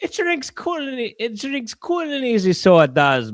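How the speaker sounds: Irish accent. Shown as a demonstration. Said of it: "trying to sound Irish".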